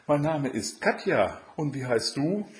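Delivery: put-on high-pitched young storybook heroine; Katja. A narrator's reading. Katja introduces herself.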